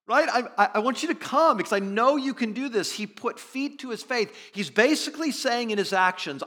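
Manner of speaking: high, loud sermon delivery; impassioned preaching. Acted. Preacher urging that he really believed, impassioned.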